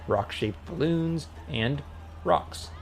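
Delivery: plain reading voice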